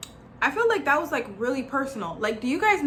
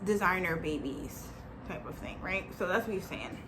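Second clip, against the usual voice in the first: ordinary delivery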